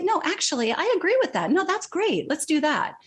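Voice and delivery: high-pitched mimicked colleague falling in line; mock someone readily going along with the group, voice lifted high; eager agreement. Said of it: "everyone just agrees with that person".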